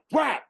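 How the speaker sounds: sharp high yelled whack like a snare hit; imitated snare drum crack. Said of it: "snare drum hit imitated".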